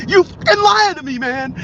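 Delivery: high, loud, raging yell; furious shout. Retold or imitated. Terrorist screaming at Garber for lying, high furious shout. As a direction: scream the accusation with a strained edge.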